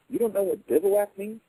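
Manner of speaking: high, disbelieving officer's challenge; incredulous officer. Retold or imitated. Union officer in the boy's face, high incredulous questioning.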